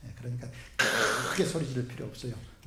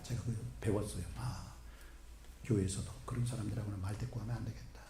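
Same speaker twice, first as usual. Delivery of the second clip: ordinary talk, nothing marked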